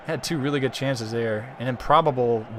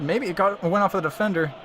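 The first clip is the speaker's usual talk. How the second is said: normal talking voice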